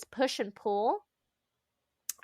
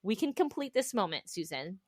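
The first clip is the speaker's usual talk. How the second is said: calming self-talk; 5 semitones lower, 5 dB quieter than usual. Retold or imitated. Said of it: "And I'm like".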